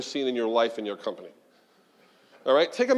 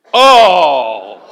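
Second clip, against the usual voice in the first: loud dismayed oh; 12 dB louder than usual. Real, not acted. Reacting to low scores.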